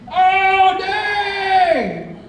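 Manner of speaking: high, loud joyful yell across the boat; excited scream. Retold or imitated. Guy on the other balcony screams with delight. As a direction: shout it out thrilled from a balcony. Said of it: "just screams".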